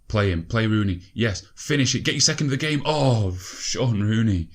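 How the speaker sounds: will the striker to score; loud excited urging, dragged out at the end; urging shout. Real, not acted. Urges players at a chance.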